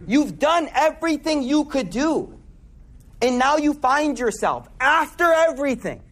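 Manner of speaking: rising sermon. Real, not acted. Preacher urges struggling listeners toward Allah, building intensity.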